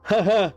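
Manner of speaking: nervous laugh. Real, not acted. Nervous laugh at scary game.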